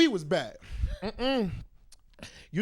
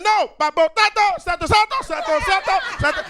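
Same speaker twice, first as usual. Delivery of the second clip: high loud imitated Spanish-accented grandpa; hammed-up accented old man; grandfather's accent; 9 semitones higher, 6 dB louder than usual. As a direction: mimic the grandfather insisting. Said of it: "He said".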